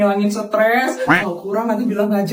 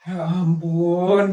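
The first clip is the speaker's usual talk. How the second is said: ordinary talk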